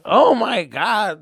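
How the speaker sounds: loud, stretched-out wail; hammed-up anguish; mock crybaby. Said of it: "I was freaking Mister Crybaby".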